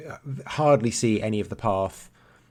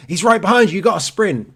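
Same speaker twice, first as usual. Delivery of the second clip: marshal's jokey yell, higher and louder; mock shout; 10 semitones higher, 9 dB louder than usual. Race marshals shout at runners climbing the finish hill, hammed-up yell. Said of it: "shout at you saying".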